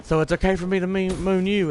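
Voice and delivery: mom teasing back. Retold or imitated. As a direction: call back to the son, teasing him like his mom. Mom mocking her son in background.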